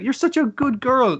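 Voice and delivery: put-on high-pitched praise, as to a child; praising a little girl. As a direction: imitate cooing approval at a little girl. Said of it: "says to the little girl".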